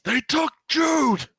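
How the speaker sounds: high, piercing, drawn-out scream of panic; father screaming that his son was taken; Abraham screams. Acted. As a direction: scream it out, terrified for the lost boy. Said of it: "And Abraham screams".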